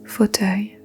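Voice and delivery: ordinary talk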